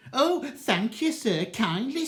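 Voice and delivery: blazing sarcasm. Acted. Mother answers the magistrate, high loud sarcastic thanks. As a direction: spit out mock politeness, furious. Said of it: "mother got proper blazing".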